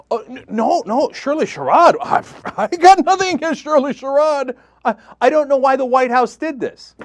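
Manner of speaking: high, flustered mock denial with a laugh; mock panic. Host imitating Breitbart denying it in mock panic, high and flustered. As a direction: play a caught liar stammering in fake panic. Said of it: "in the same panicked way".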